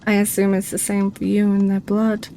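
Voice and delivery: ordinary delivery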